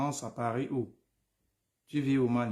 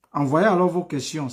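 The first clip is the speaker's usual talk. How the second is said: calling out; 5 semitones higher, 8 dB louder than usual. Real, not acted. Urging viewers again.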